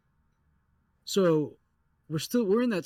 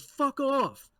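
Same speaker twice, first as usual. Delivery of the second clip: mock yell; 10 semitones higher than usual. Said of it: "he goes".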